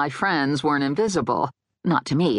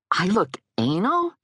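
higher, affronted question; gasped disbelief; 5 semitones higher than usual. A narrator's reading. Woman misreads his remark about her, gasping in offence. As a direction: react as if insulted. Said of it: "I gasped".

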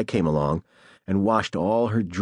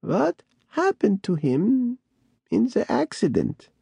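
put-on creaky old voice; 10 semitones higher than usual. A narrator's reading. Bat Lady asks.

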